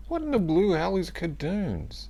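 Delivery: very high, incredulous exclamation; baffled outburst. Real, not acted. Host reacting to an unknown pizza topping, baffled.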